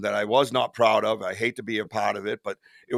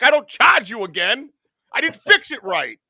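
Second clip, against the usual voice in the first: normal talking voice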